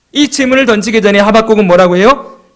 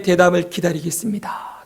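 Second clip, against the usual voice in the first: hushed whisper, softer; 3 semitones lower, 8 dB quieter than usual. Acted. Preacher whispers Habakkuk's patient waiting. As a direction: breathe the words, barely voiced. Voicing Habakkuk awaiting God's answer.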